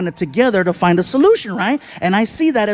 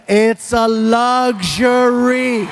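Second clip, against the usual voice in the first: luxury stretched out very slowly; drawn-out LUXURY; much slower than usual. Retold or imitated. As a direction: spell out luxury slowly, as if lecturing. Acted scolding, word drawn out.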